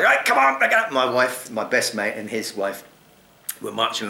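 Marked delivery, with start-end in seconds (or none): 0.0-0.9 much higher, louder hurry-up call. Retold or imitated. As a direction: chivvy everyone along with a raised call. Urging his group to move.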